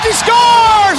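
drawn-out high shouted goal call. Real, not acted. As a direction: belt out the goal slowly.

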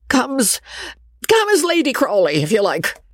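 put-on an old man pleading for her return; pleading old baronet. A narrator's reading. Baronet proposes.

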